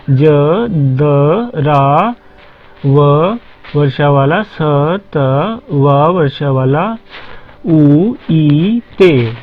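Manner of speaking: spelling out letters. Shown as a demonstration. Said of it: "अकेले अकेले अक्षर पढ़िए".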